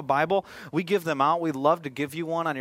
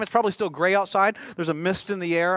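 usual speaking voice

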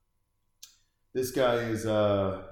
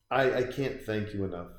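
ordinary talk

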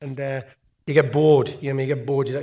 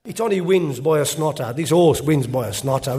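normal talking voice

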